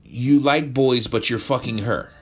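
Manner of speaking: high, loud exasperated telling-off; incredulous scolding. Acted. Friend scolding John about the girlfriend, high exasperated outburst. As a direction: shake him out of it.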